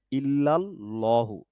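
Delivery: ordinary talk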